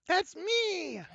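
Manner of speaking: delighted Lulu. Acted. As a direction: squeak with delight at her own image. Lulu reacts to tapestry.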